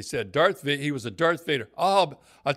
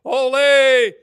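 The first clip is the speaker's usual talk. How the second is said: put-on much higher and louder drunken holler; mock hollering; 12 semitones higher, 10 dB louder, much slower than usual. Drunk teammate hollering on the ice during practice, loud. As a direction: belt it out like a happy drunk on the ice. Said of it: "He kept hollering".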